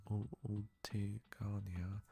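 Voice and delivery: whispering Ultigania to himself; muttered whisper. Real, not acted. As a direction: try the word again under your breath. Struggling to pronounce the name.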